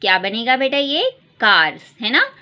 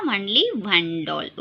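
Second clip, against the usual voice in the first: only stretched, slow and lower; slow model answer; 3 semitones lower, 3 dB quieter, much slower than usual. Shown as a demonstration. Teacher repeating the answer slowly for children. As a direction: drag it out for the class. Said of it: "कितने doll है बेटा".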